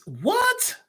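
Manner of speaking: sudden very high-pitched disbelief; shocked what. Real, not acted. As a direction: blurt it out, stunned by the comment. Reading a shocking chat comment.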